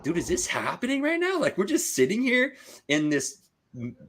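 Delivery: replaying the moment of total disbelief; stunned disbelief. Telling the host how he whispered to his friend on the couch, in amazed disbelief. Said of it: "I look at him like".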